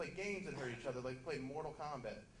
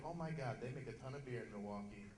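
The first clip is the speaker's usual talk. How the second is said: naive first-timer; 5 semitones lower, 9 dB quieter than usual. Retold or imitated. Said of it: "someone's drinking it for the first time".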